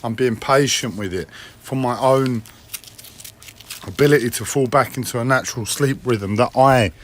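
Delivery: ordinary talk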